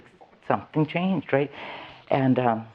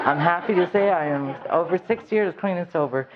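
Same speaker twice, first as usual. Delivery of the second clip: high, joyful laughing pride; beaming through a laugh; 7 semitones higher than usual. Real, not acted. Laughing as she tells the audience she is clean. Proud sobriety announcement laughing.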